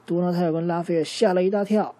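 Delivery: ordinary delivery, nothing marked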